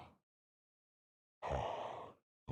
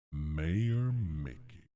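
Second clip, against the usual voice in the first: loud, slow calling out; normal villain voice; 9 dB louder, much slower than usual. Shown as a demonstration. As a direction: draw the name out loudly. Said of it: "when we talk normal".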